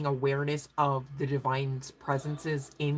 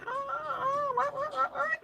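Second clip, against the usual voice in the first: very high, laughing mock gasp of disbelief; mock shocked churchgoer; 4 dB quieter, slower than usual. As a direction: squeak like a scandalized churchgoer. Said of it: "religious community, they don't like".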